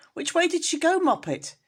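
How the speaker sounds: high, loud urgent question; a mother pressing her kitten for answers; alarmed demand. Acted.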